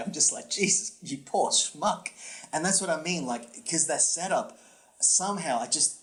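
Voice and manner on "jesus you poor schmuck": slow, high-pitched commiseration; exasperated sympathy, slowed down; pitying. Retold or imitated. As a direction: feel for the poor guy, drawing it out. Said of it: "I'm feeling for the guy".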